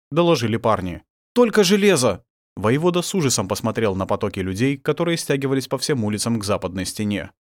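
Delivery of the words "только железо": high, loud exclamation; shouted battle report; urgent report. A narrator's reading. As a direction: call out the alarming find. Fighters urgently report to commander.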